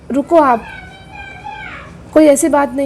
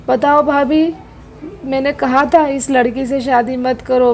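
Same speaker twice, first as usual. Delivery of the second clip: normal talking voice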